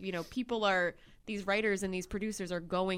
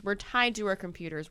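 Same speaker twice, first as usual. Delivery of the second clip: ordinary talk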